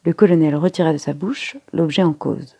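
plain reading voice

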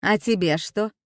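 brusque canteen-woman voice; snorting question. A narrator's reading. Canteen woman asking the frozen girl for her order, snorting. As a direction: prod a dawdling customer. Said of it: "хмыкнула буфетчица".

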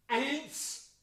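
high, frantic counting that ends in a scream; building count to a shout. Acted. Mad mayor counting to a shout.

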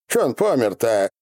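ordinary delivery

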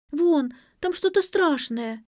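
put-on high, drawn-out scared child voice; frightened child. A narrator's reading. Girl shows what is under the sofa, frightened and high. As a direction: point out the danger in a high, slow wail.

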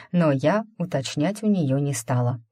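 ordinary delivery, nothing marked

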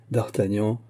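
ordinary delivery, nothing marked

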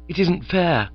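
earnest, pressing on "isn't"; warmly earnest. Acted. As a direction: insist it's unfair with feeling. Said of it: "said Claude warmly".

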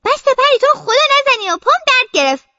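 breathless pleading friend. Acted. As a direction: plead to stop chasing, out of breath. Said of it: "میگه".

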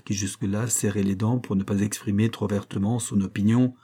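ordinary delivery

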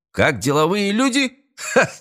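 high incredulous mocking echo; mock the phrase, rising high, then a "ha"; scoffing laugh. A narrator's reading. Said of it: "Эстебан рассмеялся".

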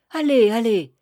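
loud, breezy wave-off; brisk dismissal. Acted. Baker brushing off the roofer's thanks, loud and breezy. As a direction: shoo away the thanks, kindly.

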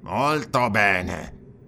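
very slow, grunted assent; drawn-out grunt of consent; grudging grunt. A narrator's reading. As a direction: agree reluctantly, dragging out each word. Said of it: "grugnì Dionus".

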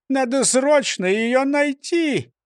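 high, slowed urging; pleading insistence. A narrator's reading. Mayor urges a search for his parrot, high-voiced pleading. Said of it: "возразил бургомистр".

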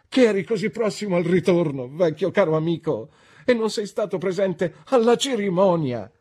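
high, loud, tumbling excitement; excited exclamation. A narrator's reading. Traddles laments his friend missed the ceremony, excited exclamation. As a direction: exclaim it to a dear old friend. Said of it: "esclamò Traddles".

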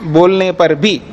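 slow dictation. Acted. Dictating the sutra slowly.

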